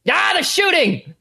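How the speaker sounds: high, loud panic; panicked yell. A narrator's reading. Enemy yells in panic at incoming shots. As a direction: cry out in alarm. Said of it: "you're like".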